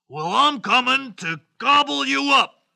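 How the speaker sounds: loud, high-pitched troll threat; big hammed-up troll voice; menacing troll. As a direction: threaten like a hungry storybook troll. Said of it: "said the troll".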